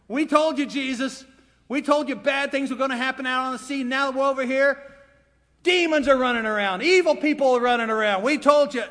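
high, insistent disciples' protest; told-you-so complaint. Acted. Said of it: "the disciples have got to be saying".

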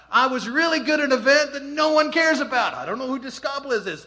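telling the audience nobody cares, in a high loud rant; exasperated rant. Real, not acted. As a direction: vent the frustration, loud and high.

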